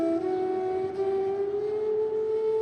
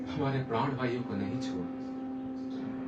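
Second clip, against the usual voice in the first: low, slow and very soft; hushed longing; 6 semitones lower, 14 dB quieter, slower than usual. Acted. King pining in his queen's chamber, hushed longing. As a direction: let it sink to a slow, soft murmur. Lovestruck king sighing over her scent.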